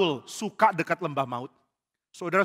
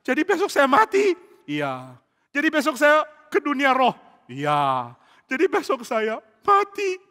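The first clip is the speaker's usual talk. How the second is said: high, loud panicked questions; a king panicking at his own death; terrified; 9 semitones higher, 7 dB louder, slower than usual. Acted. Saul asking the spirit if he dies tomorrow, panicked and high. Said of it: "Saul makin takut".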